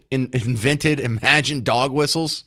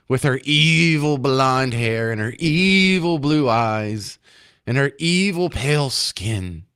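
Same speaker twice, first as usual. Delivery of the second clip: slow, drawn-out sarcastic menace on each "evil"; mock sinister sarcasm; slower than usual. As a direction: stretch out "evil" mockingly. Mocking imagined reversed-role outrage.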